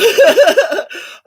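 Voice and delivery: high loud cackle; high cackling laugh. Real, not acted. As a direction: cackle with delight. Laughs at own joke.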